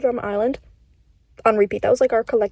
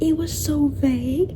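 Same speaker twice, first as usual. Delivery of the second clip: ordinary talk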